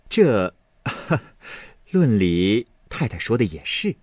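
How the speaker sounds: small chuckle after the first word; laughing. A narrator's reading. Fengjie deferring to Lady Wang before objecting, lightly laughing. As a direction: agree with a light, acted laugh. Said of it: "凤姐笑道".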